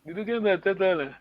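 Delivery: usual speaking voice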